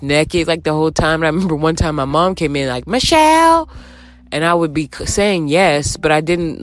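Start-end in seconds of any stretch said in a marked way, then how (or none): 2.9-3.7 high called-out name, mom at the door; mom calling out. Retold or imitated.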